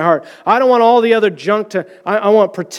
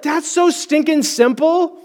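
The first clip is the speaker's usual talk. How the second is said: amazed quote; 9 semitones higher than usual. Retold or imitated. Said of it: "I was like".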